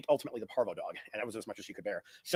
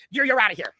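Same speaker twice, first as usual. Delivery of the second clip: loud eviction order; 7 semitones higher, 9 dB louder than usual. Retold or imitated. Landlord ordering tenant out.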